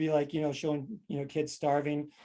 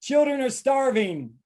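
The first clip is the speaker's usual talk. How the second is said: higher, slow mock lament; parent's guilt trip; 7 semitones higher, 4 dB louder, slower than usual. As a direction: lay on the guilt, drawn out. Parent's guilt-trip saying acted.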